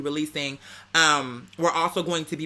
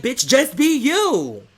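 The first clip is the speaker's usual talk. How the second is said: higher, louder, drawn-out plea; slow, high, loud just be you; emphatic call out; 7 semitones higher, 5 dB louder, much slower than usual. Real, not acted. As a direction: stretch it out and belt it. Emphatic advice to wannabes.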